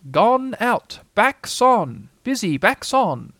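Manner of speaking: high, loud, deliberate owl voice; Owl reading aloud. Acted. Owl reads the notice to Rabbit, high and self-important. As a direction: read it out grandly, phrase by phrase.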